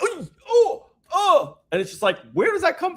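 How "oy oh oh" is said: very high, loud "Oy, oh" wail; foreign-style cheer. Retold or imitated. Showing friends his odd World Cup cheer, very high. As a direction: cry out like a World Cup fan. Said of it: "But this, I'm like".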